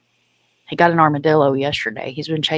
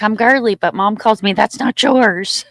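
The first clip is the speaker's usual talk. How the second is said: high, loud dog-character voice; pitched-up, louder mock pet voice; imitated dog; 5 semitones higher, 6 dB louder than usual. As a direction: speak as a pet proudly introducing itself. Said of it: "She's like".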